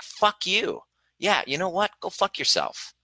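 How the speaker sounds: high, sassy dismissal; mock telling off. Coach demonstrating healthy aggression to intrusive messagers, mock sassy. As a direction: fling it off with playful aggression. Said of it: "so many times that it's like".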